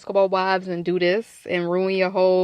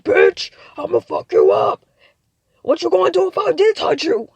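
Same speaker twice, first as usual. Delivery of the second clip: mad shouting; 8 semitones higher, 6 dB louder than usual. Shown as a demonstration. Host showing how she sounds when mad, loud threatening shout. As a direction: yell it like you're about to fight. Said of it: "I get like that when I get mad".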